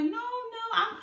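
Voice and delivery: high, quick-to-deny retold voice; insistent protest. Assuring paramedics her heart slowed, insistent protest.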